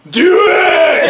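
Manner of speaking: high, drawn-out heckler's shout; imitated yell. Imitating some guy in the audience, yelling. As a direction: holler it like a guy in the crowd. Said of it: "I heard some guy yell".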